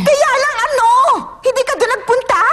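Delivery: high, exasperated questioning; angry challenge. Acted. Angry sister cuts off excuse.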